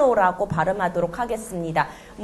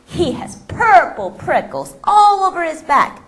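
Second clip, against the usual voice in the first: slow, louder storybook reading; story narration; 5 dB louder, much slower than usual. Acted. Reading the Gruffalo's description to the class. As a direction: read it slowly with big expression.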